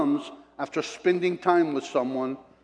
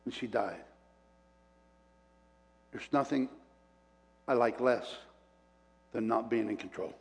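much softer, lower, tender; hushed grief; 5 semitones lower, 8 dB quieter than usual. Real, not acted. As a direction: let it sink to a near hush. Grief, tender sermon moment.

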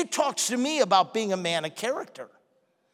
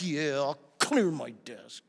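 low, soft, sulky student; sulky mutter; 9 semitones lower, 8 dB quieter than usual. Retold or imitated. Bored student answering the teacher, low and sulky. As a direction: grudgingly go along, quiet.

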